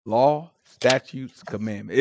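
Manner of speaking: slow, hushed reverent listing; drawn-out, solemn recital; a slow pious chant of the words; Hebrew catchphrase. Retold or imitated. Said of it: "the Hebrew one is".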